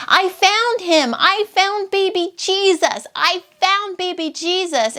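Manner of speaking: high-pitched mock yell of delight; excited toddler yelling. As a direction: imitate a two-year-old announcing a big discovery.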